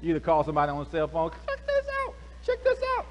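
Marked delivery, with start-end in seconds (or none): 1.5-3.1 high, squeaky excited call to a friend; a giddy onlooker phoning it in; excited bystander. Retold or imitated. Excited caller on phone.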